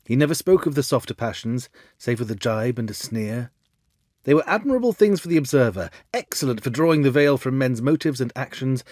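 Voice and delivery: ordinary delivery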